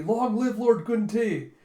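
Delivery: slow, high, loud chant; acclaiming cheer. Retold or imitated. Commoners praying for Lord Guntei's victory, loud acclaim. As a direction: hail your lord at length.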